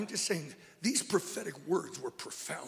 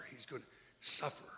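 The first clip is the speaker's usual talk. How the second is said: hushed; 6 semitones lower, 8 dB quieter, faster than usual. Acted. Hushed telling of Jesus' suffering.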